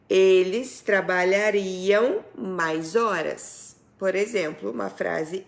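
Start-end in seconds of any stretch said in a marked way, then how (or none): 0.1-3.7 slow example sentence. Shown as a demonstration.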